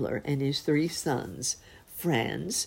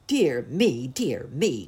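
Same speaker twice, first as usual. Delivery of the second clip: 1 pleading dwarf; 5 dB louder, slower than usual. Acted. Dwarf voice at the door.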